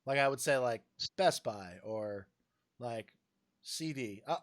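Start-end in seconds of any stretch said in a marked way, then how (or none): 0.6-2.3 Best slow and stressed, Buy lighter; slow demo stressing Best. Shown as a demonstration. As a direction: lean on the first word Best. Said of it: "emphasize the first word".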